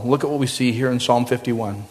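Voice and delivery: ordinary talk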